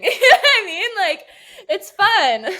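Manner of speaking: high, loud laughing question. Real, not acted. Guest laughs to the host about sad pop. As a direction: laugh it out while asking.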